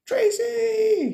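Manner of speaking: high joyful shout of a name; excited shout. Real, not acted. Greeting viewer joyfully, shouted.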